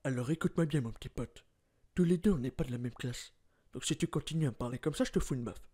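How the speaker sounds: low quiet threat to a little punk; menacing tough guy. Acted. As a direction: tough guy warning someone he'll slap him. Tough-guy threat, first take.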